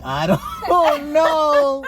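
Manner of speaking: loud, high, drawn-out wail; exaggerated comic despair; mock exasperation. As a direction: stretch it into a hammed-up wail. Retells his exasperated reaction.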